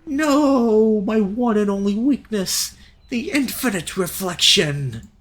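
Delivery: high, loud, slow melodramatic death cry; milk the death scene, big and slow; hammed-up dying villain. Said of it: "acting up a storm in my death scene".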